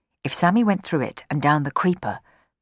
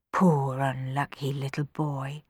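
softer, slow pitying murmur; 3 semitones lower, 6 dB quieter, slower than usual. Acted. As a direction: murmur it to yourself with pity. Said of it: "murmured Rowan".

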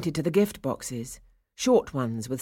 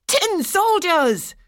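very high, loud, drawn-out cheer; excited cry; 8 dB louder, much slower than usual. A narrator's reading. Boys unwrapping their new toys, excited cry. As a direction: shout it high, stretching each word. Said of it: "cried several of the boys".

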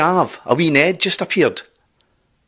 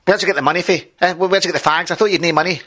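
put-on nagging girlfriend; 5 semitones higher, faster than usual. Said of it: "his girlfriend turns around and says".

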